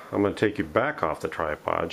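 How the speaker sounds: ordinary talk, nothing marked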